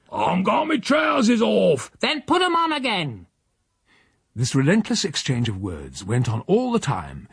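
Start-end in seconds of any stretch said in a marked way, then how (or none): 0.1-1.9 slow, loud high protest; Silas shouting back. Acted. Silas to his departing housekeeper, slow loud protest. 2.0-3.3 high, dry comeback; tart housekeeper. Acted. Housekeeper to Silas, high dry comeback.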